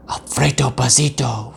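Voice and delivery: plain reading voice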